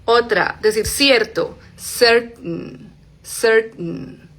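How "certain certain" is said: "certain" with the T barely sounding, nasal n after it; glottal T. Shown as a demonstration. Teacher showing the T almost vanishes, glottal T. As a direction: stop "certain" in the throat at the T. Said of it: "casi ni suena esa T".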